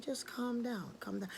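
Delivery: hushed calming voice; soothing. Acted. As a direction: quietly settle someone in a panic. Obatala soothes the panicking bird.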